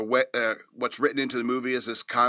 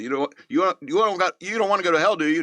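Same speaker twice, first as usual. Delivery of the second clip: put-on light Israeli accent, pitched up; 6 semitones higher, 4 dB louder, faster than usual. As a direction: imitate a thick Israeli accent. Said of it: "he has that thick, uh, Israeli accent".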